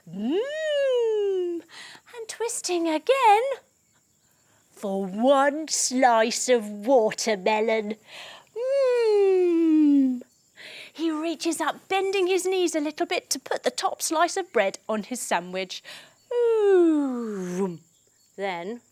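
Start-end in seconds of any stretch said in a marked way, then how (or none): 0.1-1.6 high savoring hum; a high happy mm; high yummy mm. Acted. Teacher to kids, caterpillar savoring food, high yummy mm. 8.6-10.2 loud high savoring hum; a high happy mm; high yummy mm. Acted. Teacher to kids, caterpillar savoring food, high yummy mm. 16.3-17.8 savoring ooh; a happy eating ooh; delighted ooh. Acted. Teacher to kids, caterpillar eats sandwich, delighted ooh.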